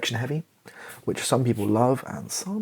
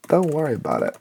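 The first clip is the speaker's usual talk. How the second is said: raised, higher call to brush it off; loud brush-off; 5 semitones higher, 5 dB louder, much faster than usual. Acted. Twelve-year-old son brushing off his mum, loud and higher. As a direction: shout it off dismissively.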